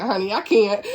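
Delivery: normal talking voice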